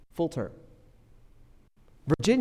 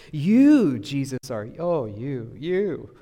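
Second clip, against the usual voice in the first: flattering tone; 5 dB louder, much slower than usual. Acted. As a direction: fawn over him, leaning on every "you". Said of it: "read it on purpose with that tone".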